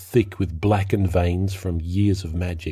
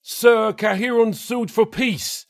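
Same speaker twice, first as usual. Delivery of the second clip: high, slow urgent report; shouted; slower than usual. A narrator's reading. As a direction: shout the news over the noise. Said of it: "Astor shouted".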